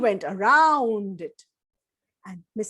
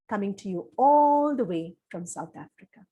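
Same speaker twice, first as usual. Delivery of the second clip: plain reading voice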